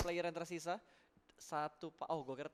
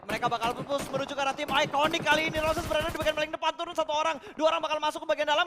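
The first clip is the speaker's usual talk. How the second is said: loud, high, racing esports call; full-force play-by-play; 11 semitones higher, 11 dB louder than usual. Real, not acted. Caster calling the push on Iconic, excited play-by-play. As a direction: shout the push as it unfolds.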